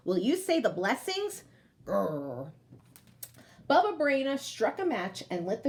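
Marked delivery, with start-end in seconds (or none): 1.8-2.5 low bear growl. Acted.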